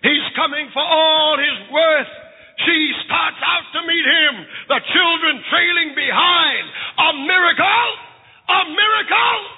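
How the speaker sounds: high, impassioned preacher's cry; fiery preaching. Acted. Preacher to congregation, fervent high preaching. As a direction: preach it with a catch in the voice.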